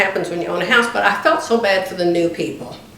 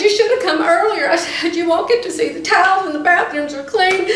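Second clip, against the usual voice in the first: teasing, laughing; 7 semitones higher than usual. Retold or imitated. Teasing the agent for coming late.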